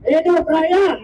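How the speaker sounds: shouted rally cry. Real, not acted. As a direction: rouse the crowd with a shout. Rally call and response.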